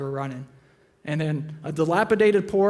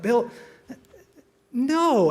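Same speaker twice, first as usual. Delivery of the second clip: shocked protest; 7 semitones higher than usual. Retold or imitated. Friends reject Bill's idea.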